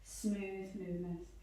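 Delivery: soft, slow, smooth yoga cues; gentle and slow. Shown as a demonstration. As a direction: ease the class into slow gentle movement. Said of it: "let's just really gentle, really slow".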